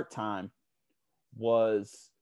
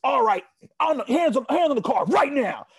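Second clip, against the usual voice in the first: loud, high barking police command; sudden loud shouted police order; cop's barked order; 8 dB louder than usual. Retold or imitated. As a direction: bark it like a cop ordering hands on the car. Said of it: "the car says".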